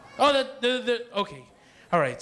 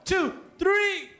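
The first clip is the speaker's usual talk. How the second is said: building countdown; 8 semitones higher, much slower than usual. Real, not acted. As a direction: count rising higher to a shouted three. Second louder crowd countdown.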